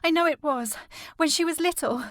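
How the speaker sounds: high, loud, upset cry; distraught insistence. Acted. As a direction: protest desperately, still shaken by the nightmare. Insisting the dream was her sister.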